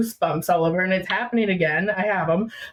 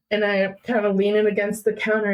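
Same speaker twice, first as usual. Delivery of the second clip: normal talking voice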